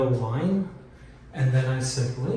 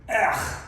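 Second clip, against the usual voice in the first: disgusted ugh; 8 semitones higher than usual. Real, not acted. Ugly sliver of wallpaper left.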